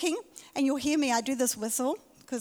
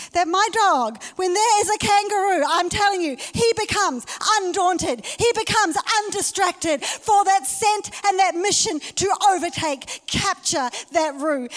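louder, higher, then slowing on the mission; building excitement; 6 semitones higher, 7 dB louder than usual. Acted. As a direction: paint the dog's fierce chase. Builds preaching about the dog.

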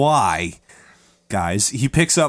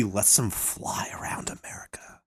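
dramatic whispered aside; 4 semitones lower, 12 dB quieter than usual. Acted. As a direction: whisper it like a secret. Spooky aside in story telling.

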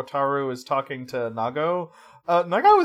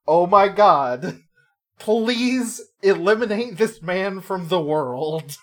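slow, drawn-out plea, louder and higher; exasperated plea; 4 semitones higher, 6 dB louder, slower than usual. Retold or imitated. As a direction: beg the heavens in mock exasperation. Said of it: "And she's like".

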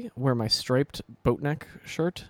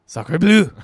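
hammed-up French accent, higher and louder; mock French accent; 5 semitones higher, 10 dB louder than usual. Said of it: "the French people will be like".

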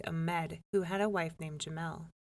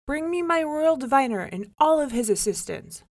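higher, louder royal order; commanding king; 7 semitones higher, 9 dB louder than usual. A narrator's reading. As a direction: bark the order to court. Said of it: "he commanded".

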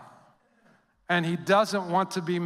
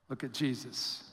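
much softer, hushed answer; 6 semitones lower, 11 dB quieter than usual. Acted. Preacher answering the congregation, hushed. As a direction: let it fall to a hush. Sermon refrain after question.

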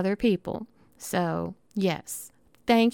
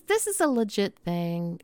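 ordinary talk

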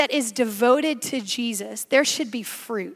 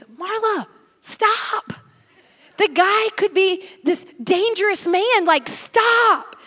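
higher, urgent friend telling her to stop; worried friend; 7 semitones higher, slower than usual. Retold or imitated. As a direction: warn her off like an alarmed friend. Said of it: "And he goes".

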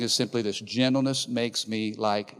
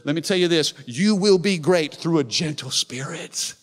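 raised, ringing pulpit voice, pushing up; forceful preaching; 6 semitones higher, 4 dB louder than usual. Acted. Pastor driving the point home to the congregation, loud preaching.

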